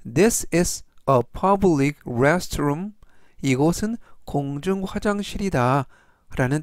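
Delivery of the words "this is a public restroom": very slow, careful English; slow model sentence. Shown as a demonstration. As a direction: read it slowly for learners. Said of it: "전체적 의미는". (아주 천천히 또박또박 읽는 예문)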